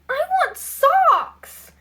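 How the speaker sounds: very high, slow, loud demand; exasperated high shout. A narrator's reading. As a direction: shout it drawn out and high-pitched. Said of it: "shouted Maggie".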